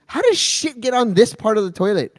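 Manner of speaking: imitating a pissed-off partner, high and loud; Michelle. Partner scolding him about the dirty toilet, mock pissed-off. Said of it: "Michelle will get pissed at me".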